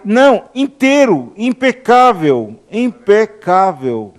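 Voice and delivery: rising into joyful proclamation; preacher rejoicing over the new body; excited preaching. Real, not acted. Preacher exulting over restored body.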